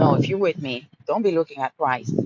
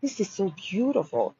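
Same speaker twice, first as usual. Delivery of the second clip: hushed awe; 3 semitones higher, 6 dB quieter than usual. Retold or imitated. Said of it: "And I was like".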